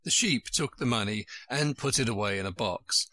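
ordinary delivery, nothing marked

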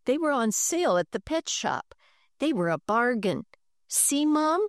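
put-on pleading boy.

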